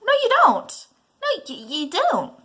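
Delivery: high, loud shocked protest; outraged cry. Acted. Son cutting off his mother, high and loud. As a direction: blurt it out in shock.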